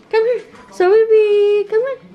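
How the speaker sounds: high, loud coaxing call. Real, not acted. Owner calling her dog over, high excited coaxing. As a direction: call the puppy over eagerly.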